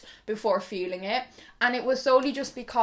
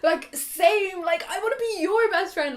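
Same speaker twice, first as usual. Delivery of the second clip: excited; 7 semitones higher, 5 dB louder than usual. Retold or imitated. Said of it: "I'm like".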